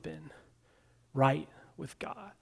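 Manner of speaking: breathy whispered aside, no voice. Real, not acted. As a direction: drop to a hushed whisper for the last words. Sermon aside, voice drops.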